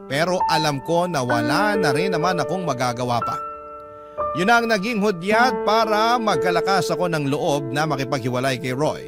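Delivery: ordinary talk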